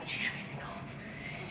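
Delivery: slow, awed whisper of the words; whispered echo. Retold or imitated. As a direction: breathe it out slowly like a revelation. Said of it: "They look at me and they go".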